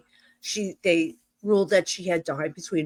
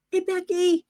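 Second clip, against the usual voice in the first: high, strained mock voice of a screaming woman; high garbled mock screech; 10 semitones higher than usual. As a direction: imitate her high-pitched cry without full volume. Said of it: "this woman wakes me up screaming in my ear".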